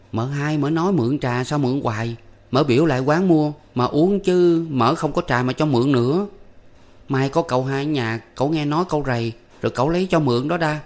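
put-on boy telling his mother what the aunt said, childlike; young boy. A narrator's reading. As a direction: report it like an eager little boy. Boy reports aunt's words.

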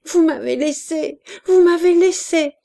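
high, loud panicked cry; frightened cry. A narrator's reading. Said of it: "criait-elle". (cri affolé d'enfant abandonnée)

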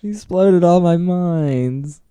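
high, drawn-out Australian complaint; hammed-up Australian whine; mock Australian lament. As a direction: imitate an aggrieved Australian, stretched out. Mimicking the Australian mine guy.

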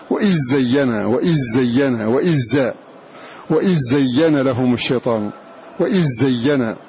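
وإذ زين said "wa-izzayyina", with a doubled ز; dh merged into z. Shown as a demonstration. Teacher drilling the merge for students: وإذ زين as "wa-izzayyina". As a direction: let the ذ melt into the ز of زين. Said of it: "مضغمة فيها هذه الذال".

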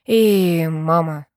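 slow, slightly hoarse; suddenly husky. A narrator's reading. Driver speaking of a family not their own, husky. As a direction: drag the words out as the voice thickens. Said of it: "мой голос неожиданно сипнет".

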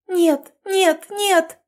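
woman screaming for the beating to stop. A narrator's reading. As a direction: scream no in desperation. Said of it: "закричала Жанна".

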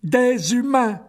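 high, loud spider announcing prey; clicking spider cry. Acted. Spider reports its captured humans, high and loud. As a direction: shout it out like a giant spider. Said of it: "cliqueta l'araignée".

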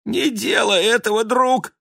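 whimpering plea. A narrator's reading. The dog begging his friend not to jump, whining. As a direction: whine like a frightened dog. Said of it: "заскулил пёс-балбес".

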